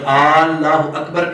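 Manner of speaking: اللہ opened on a long stretched 'Aaa'; wrong doubled alif. Shown as a demonstration. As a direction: double the first vowel of اللہ into 'Aal-laahu'. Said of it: "یہ دو الف بن جائیں گے". (اللہ کے شروع میں دو الف کی لمبی آواز)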